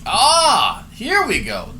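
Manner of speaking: loud, high groan of here it comes; knowing exasperation; exasperated here we go. Real, not acted. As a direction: sigh at the predictable. Reacting to co-host's rant.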